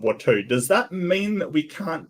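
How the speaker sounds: high, loud disbelief; stumbling what at the end; can't believe the logic; incredulous. Real, not acted. Reacting to clip.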